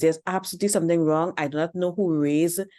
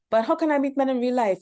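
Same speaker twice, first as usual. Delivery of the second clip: pitched-up mock voice of a confused young woman; mocking whiny woman; 7 semitones higher, faster than usual. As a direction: mimic her helpless question, higher. Mock objection from viewer.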